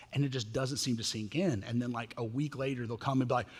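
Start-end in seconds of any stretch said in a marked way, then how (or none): none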